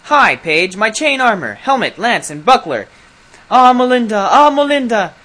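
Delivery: loud, high battle shout; rousing war cry. Acted. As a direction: call for your armor like a charging knight. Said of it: "which was his war cry".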